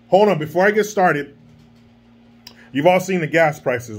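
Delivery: normal talking voice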